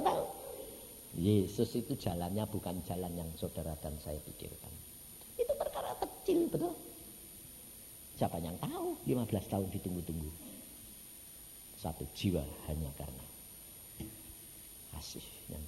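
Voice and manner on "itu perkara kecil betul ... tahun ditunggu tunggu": ordinary talk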